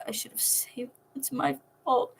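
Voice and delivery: broken, half-whispered through tears; sobbing whisper. Acted. Will sobbing to Nico that it's his fault, whispered. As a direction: whisper it as if sobbing, barely voiced. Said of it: "Will sobbed".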